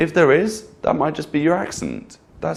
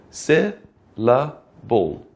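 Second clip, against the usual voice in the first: slow, split syllables; much slower than usual. Shown as a demonstration. Teacher counting syllables on the hand, slow syllable-by-syllable demo. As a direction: leave a gap between "se", "la" and "bo". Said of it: "So maybe you go".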